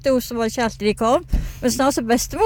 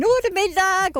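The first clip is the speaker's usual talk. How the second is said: mock dinner call; 6 semitones higher than usual. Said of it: "ropte du. Kjempehøyt".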